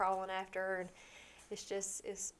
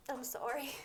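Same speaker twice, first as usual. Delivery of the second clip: small, higher tearful apology; choked up; 5 semitones higher, 4 dB quieter than usual. Real, not acted. Mother apologising to interviewers, choked up. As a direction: apologise through welling tears. Apologises while overcome by emotion.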